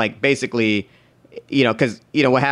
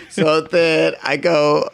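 ordinary talk

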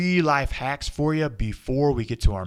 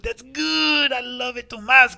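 excited shout; 9 semitones higher, 10 dB louder than usual. Acted. Excited host greets costume.

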